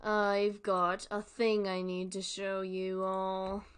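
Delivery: ordinary delivery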